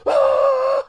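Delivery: high, loud wordless scream; long open-throated "ah" yell; imitated yell. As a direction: let out a mock holler into a phone. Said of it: "he just went like".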